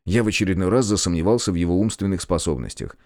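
ordinary delivery, nothing marked

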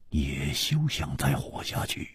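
ordinary delivery

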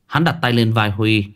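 usual speaking voice

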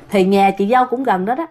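ordinary delivery

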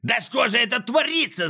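high squeal of pain and outrage; anguished high-pitched wail. A narrator's reading. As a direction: shriek as if your foot is trapped. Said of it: "завизжал он, корчась от боли".

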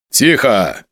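ordinary delivery

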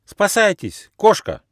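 very high-pitched warning cry; mock alarm cry. A narrator's reading. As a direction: cry out in pretend panic. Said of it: "улыбнулся и крикнул".

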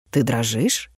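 low, quiet, barely voiced question; hushed question. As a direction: ask it softly, almost under the breath.

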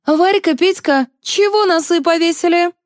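high-pitched, bossy rallying call. A narrator's reading. Liza rallying two glum administrators, bossy. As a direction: rouse them with a high, lively shout.

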